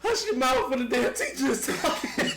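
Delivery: high, laughing scolding. Real, not acted. Laughing rant at friends.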